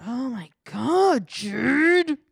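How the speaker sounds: high, loud, drawn-out whine; mock wailing boyfriend. Him pleading with Jade, hammed-up wail. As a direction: wail the name in mock exasperation.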